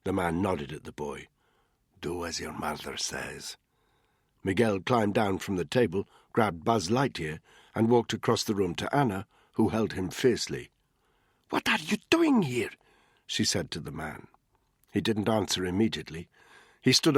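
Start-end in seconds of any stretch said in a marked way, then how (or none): 2.0-3.6 low, much softer command; quiet command, no need to raise it; the brother softly ordering the boy, low; low gangster. A narrator's reading. 11.5-12.7 much higher confrontation; a sister demanding answers, high; demanding mother. A narrator's reading. Anna confronts the man.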